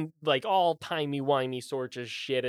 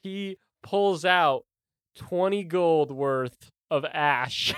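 normal talking voice

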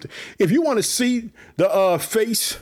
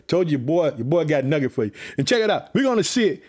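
normal talking voice

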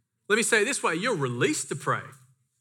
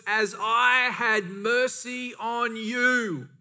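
very slow, higher-pitched reproach; angry master; 4 semitones higher, much slower than usual. Retold or imitated. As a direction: draw out each word as a master's rebuke. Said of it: "And in his anger".

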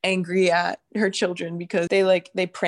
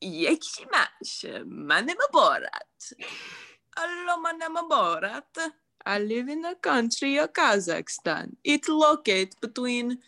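Borat impression; 5 semitones higher than usual. Third friend tries the Borat greeting. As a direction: stretch out Yechimash like Borat. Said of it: "our attempt at ... Borat".

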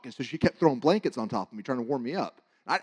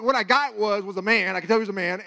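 ordinary talk

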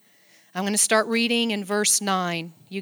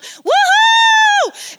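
much higher, loud whoop; joyful yell; 10 dB louder than usual. Retold or imitated. Pastor recalls ziplining with daughters, joyful whoop. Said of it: "I screamed and yelled".

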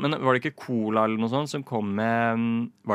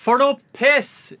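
slow, much louder, higher mock outrage; mock outraged exclamation; 12 semitones higher, 6 dB louder, slower than usual. Echoing the co-host's outburst on air, in hammed-up outrage. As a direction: bellow it like a theatrical protest.

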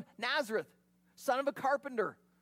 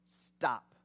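firm, low parental stop; a hint of a parent silencing a child; lightly voiced stern parent; parent scolding child; 10 semitones lower than usual. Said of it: "like a parent speaking to a disobedient child".

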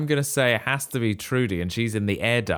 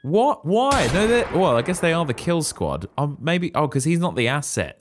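high-pitched, startled questioning; shocked disbelief; 7 semitones higher than usual. Real, not acted. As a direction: react aghast to a plot twist, voice way up. Listener learns Morgan is killed.

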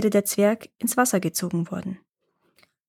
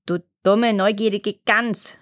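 dwarf shouting. Acted. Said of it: "schrie der Zwerg".

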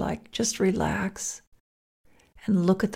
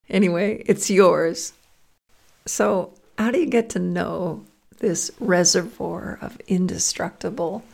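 normal talking voice

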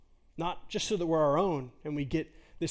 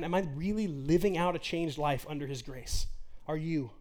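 ordinary talk

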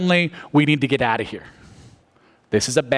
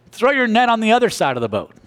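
called out from the shore; 9 semitones higher than usual. Retold or imitated. Jesus calling from shore.

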